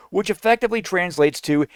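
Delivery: usual speaking voice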